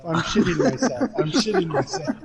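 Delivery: laughing robot voice impression. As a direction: chant it like a text-to-speech robot, laughing. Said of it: "and it's in a robot voice".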